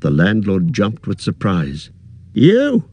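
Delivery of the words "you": high, loud startled yelp; startled cry. A narrator's reading. Startled innkeeper cries out at ranger. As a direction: jump with surprise and cry out. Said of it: "he cried".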